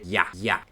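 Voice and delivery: "ya" with a Y sound for German J; German yes. Shown as a demonstration. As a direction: say "ya" twice as the German word for yes. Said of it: "sí en alemán se dice ya".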